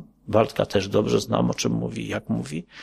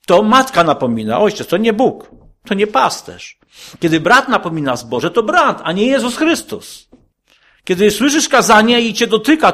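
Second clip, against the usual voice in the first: builds from firm to high, loud preaching; fiery preaching; 8 semitones higher, 5 dB louder than usual. Acted. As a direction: drive the point home louder and higher line by line. Preacher builds to full force.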